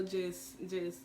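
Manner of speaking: ordinary delivery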